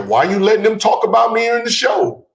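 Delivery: high-pitched imitation of a whining texter; mock complaint. Host mimicking a friend's texts during the show, high mock whine. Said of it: "Texting me during the show".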